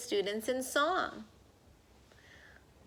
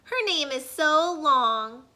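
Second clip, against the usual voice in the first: put-on kids complaining; 5 semitones higher, 6 dB louder than usual. A narrator's reading. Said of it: "they said".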